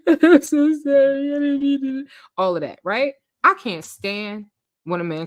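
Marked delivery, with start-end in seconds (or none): 0.1-2.1 mock sobbing apology, slow and high; imitating his crying. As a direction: ham up a grown man blubbering sorry. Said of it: "He breaks down crying".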